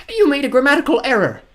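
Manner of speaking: very high, loud pedant; pedantic woman. Acted. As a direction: point out the mistake in a high voice. Woman corrects villain's grammar.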